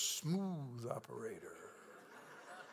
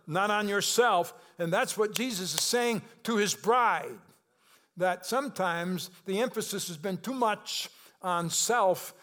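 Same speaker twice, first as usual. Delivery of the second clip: louder, higher, driving sermon voice; full-force preaching; 5 semitones higher, 5 dB louder than usual. Acted. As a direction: preach it hard to the congregation. Pastor preaching to congregation.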